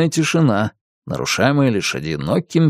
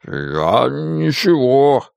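halting, drawn-out mild guilt; guilty mumbling; much slower than usual. A narrator's reading. Драко excuses himself to the witch, guiltily. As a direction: stall slowly, a touch sheepish. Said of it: "виновато развел крыльями Драко".

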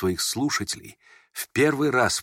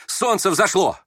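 high, excited exclamation; excited cry; 9 semitones higher, 4 dB louder, slower than usual. A narrator's reading. Feverish youth pointing out sunrise to the prince, crying out. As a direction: cry it out high, like announcing a miracle. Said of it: "вскричал он".

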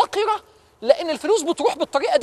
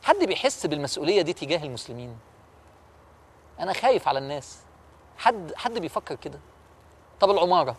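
much softer and lower, pleading with the audience; soft earnest appeal; 6 semitones lower, 5 dB quieter than usual. Real, not acted. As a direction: drop to a near hush and ask from the heart. Preacher's soft personal appeal. (مناشدة هادئة وصادقة)

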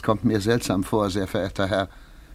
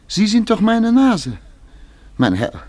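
rising, slowing into a loud higher cry; pleading protest that boils over on eigene; indignant outburst; 9 semitones higher, 5 dB louder, slower than usual. Acted. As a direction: Kowaljew confronting the nose in uniform, outraged and pleading. Kowaljew confronts his own nose.

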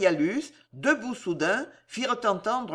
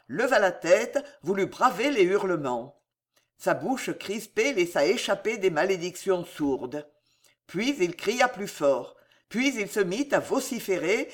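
ordinary delivery, nothing marked

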